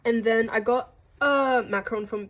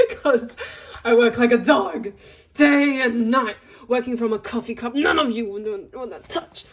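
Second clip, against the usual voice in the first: movie monologue; 2 semitones higher, 6 dB louder than usual. Pretend. To her viewers, reciting the Hidden Figures monologue: loud dramatic recitation. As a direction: belt it out like a film heroine. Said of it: "I don't know if that's the monologue".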